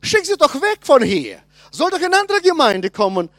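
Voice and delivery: annoyed demand. Retold or imitated.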